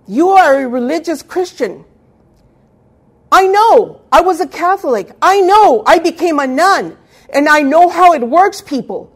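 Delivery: full-force pulpit preaching; forceful preaching. Acted. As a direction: preach it out to the whole congregation.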